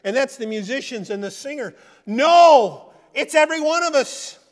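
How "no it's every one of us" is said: loud and high, hammering every one of us; loud declaration. Real, not acted. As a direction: shout it out to the whole church. Preacher shouts his point.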